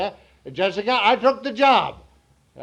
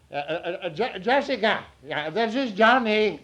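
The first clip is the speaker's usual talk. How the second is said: usual speaking voice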